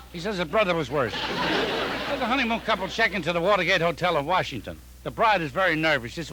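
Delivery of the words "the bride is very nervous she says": ordinary talk, nothing marked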